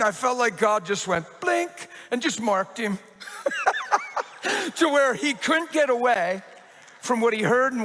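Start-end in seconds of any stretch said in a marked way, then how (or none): 3.2-4.7 high laughter. Real, not acted. Pastor laughs mid-story.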